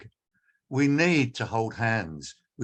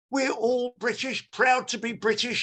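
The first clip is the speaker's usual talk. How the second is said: mocking Starmer; 11 semitones higher than usual. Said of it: "said in a speech this morning".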